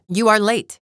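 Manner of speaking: loud, high-pitched scolding; sharp rebuke. Acted. Champion scolds the late fighter, loud and indignant. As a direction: burst out in imperious annoyance.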